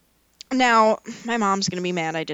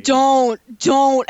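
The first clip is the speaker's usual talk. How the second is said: protesting; 4 semitones higher, 7 dB louder than usual. Real, not acted. Pleading to stop.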